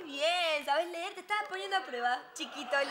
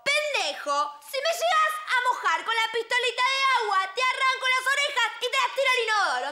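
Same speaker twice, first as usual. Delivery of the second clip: loud, raised-pitch angry threat; threatening tale prince; 6 semitones higher, 5 dB louder than usual. Acted. Storyteller as prince threatening a child, angry.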